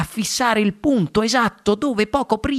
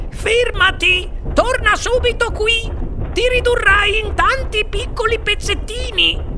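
very high, panicky screech; shrieking mother. A narrator's reading. Mother warns son racing to the camera, high shriek. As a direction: screech a frantic warning in a high voice. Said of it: "strillò la signora T V".

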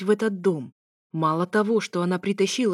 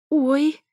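high, loud "oy!" yelp; startled squeal of pain, pitched up; sudden squeal; 8 semitones higher, 6 dB louder than usual. A narrator's reading. Alisa hurts her toes on a table, sudden squeal. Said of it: "вдруг взвизгнула Алиса".

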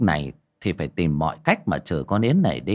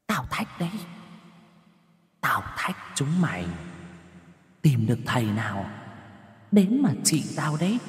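taunting ghost voice; 5 semitones higher, 6 dB quieter than usual. A narrator's reading. Ghost taunting echoing in her ears.

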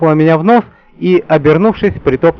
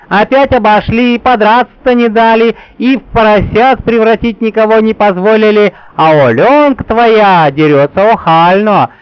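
put-on grumbling old Yaga; 6 semitones higher, 3 dB louder than usual. A narrator's reading. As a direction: grumble like a cranky old witch. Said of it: "Яга вообще ворчала". (ворчливая старуха Яга)

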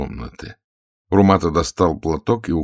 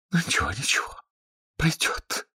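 high, hushed feminine reassurance; soft, airy comforting; soothing; 10 semitones higher, 7 dB quieter than usual. A narrator's reading. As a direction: soothe a lover in a near whisper. Lady soothing her queasy lover.

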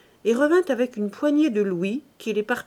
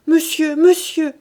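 high, loud alarmed cry; 6 semitones higher, 9 dB louder, slower than usual. A narrator's reading. Mother to her husband from her sickbed, crying out. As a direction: call out in alarm, pitch lifted. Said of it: "cria la mère en se dressant sur son lit".

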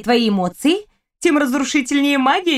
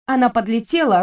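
ordinary delivery

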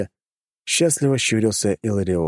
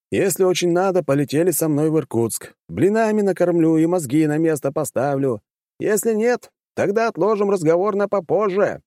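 rising voice; 10 semitones higher than usual. A narrator's reading. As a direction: start normal, build to a call. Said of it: "постепенно повышая голос".

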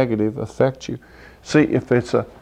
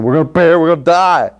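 loud, high mock panic; hammed-up terrified disciples; panicked disciples; 5 semitones higher, 5 dB louder, faster than usual. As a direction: cry out like the waves will sink you. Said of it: "They said".

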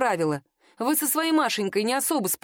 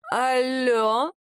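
put-on drunk voice; 3 semitones higher than usual. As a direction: answer the phone drunk. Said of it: "послышался пьяный голос Машеньки".

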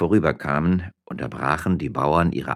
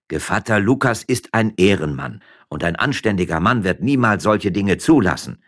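firm, louder indignant farmer; 5 semitones higher, 6 dB louder than usual. A narrator's reading. Farmer defending Lukas to his wife, indignant. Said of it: "gab der Bauer entrüstet zurück".

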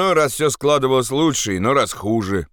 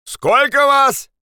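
very high, drawn-out shouted question; shouting; 4 dB louder, much slower than usual. Acted. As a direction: stretch out a high call from afar. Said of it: "тот же голос крикнул опять". (протяжный окрик)

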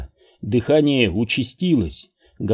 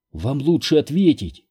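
usual speaking voice

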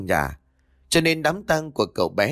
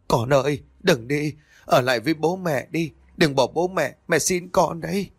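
higher, desperate pleading cry; anguished wail; 5 semitones higher than usual. A narrator's reading. Mother begging her dead son to stay, anguished wail. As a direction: beg the child to stay, rising in anguish. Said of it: "miệng gào lên trong đau đớn".

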